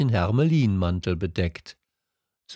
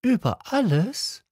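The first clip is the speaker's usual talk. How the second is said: put-on much higher, rising child question; little prince echoing the king in amazement; astonished little prince; 13 semitones higher than usual. A narrator's reading. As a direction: disbelief at the answer. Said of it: "little prince astonished".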